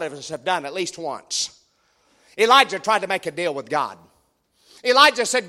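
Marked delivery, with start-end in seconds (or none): none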